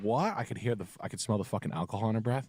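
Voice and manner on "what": put-on higher, questioning drunk voice; drunk woman. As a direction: lightly mimic a hard-of-hearing drunk. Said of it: "when she said".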